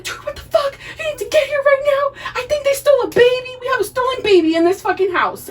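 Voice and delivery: freaked-out dad calling for help; panicked call. Retold or imitated. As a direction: babble it out in a panic. Dad panicking on phone to Rupert.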